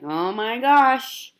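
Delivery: hammed-up loud warning to fellow passengers. Mom warning passengers about her baby, slow and loud. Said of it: "I told people".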